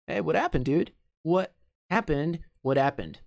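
"What happened" run together with no H; American linking, H dropped. Shown as a demonstration. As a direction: link "What" straight into "happened", H silent. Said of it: "vão juntar esse happened como se o H não existisse". ("What happened" emendado, sem som de H)

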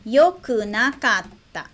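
"Yokunakatta" said slowly, syllable by syllable; slow demo. Shown as a demonstration. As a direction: model "Yokunakatta" slowly for learners. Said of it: "Passado negativo".

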